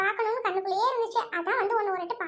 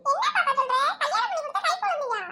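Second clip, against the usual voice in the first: laughing; 6 semitones higher than usual. Acted. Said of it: "டேய், சிரிக்காதடா".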